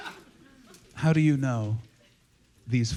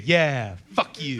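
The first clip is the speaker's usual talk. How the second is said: lower, mocking jab between friends; mock taunt; 6 semitones lower, slower than usual. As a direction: throw the mock taunt as a buddy punch. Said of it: "as if to say".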